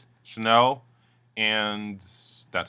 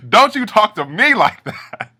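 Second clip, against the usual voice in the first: much higher, louder slow outraged snap; mock angry snap; 13 semitones higher, 12 dB louder, slower than usual. Sukhvinder snapping at Chanel, loud high outrage. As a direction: bite back, loud and high. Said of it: "Sukhvinder was like".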